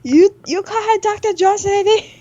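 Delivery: hammed-up cry of Doctor Jones; mock movie character. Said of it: "I would've been like".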